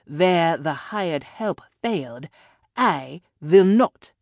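put-on slow, loud threat in a European accent; European-accented villain building to a punched finish; hard-to-place European accent. A narrator's reading. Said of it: "a hard-to-place European accent".

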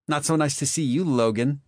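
high, sneering Marley voice. Acted. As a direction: sneer it at the twin you hate. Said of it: "Marley sneered at his twin".

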